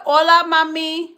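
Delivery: imitating a cab driver calling out; cab driver greeting. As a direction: holler it like a cabbie hitting on her. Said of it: "they'd be like".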